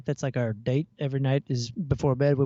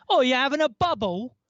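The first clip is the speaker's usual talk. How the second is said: higher, louder imitation of a British driver; imitating British driver; 7 semitones higher, 4 dB louder than usual. Driver in the van teasing them, imitating his British accent. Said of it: "he goes".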